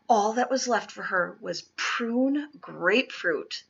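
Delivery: ordinary delivery, nothing marked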